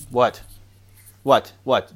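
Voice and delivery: loud exasperated repeated "What?"; shouted what. Retold or imitated. Dad yelling back at pestering kids, loud and exasperated. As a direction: bark it out like a harried dad.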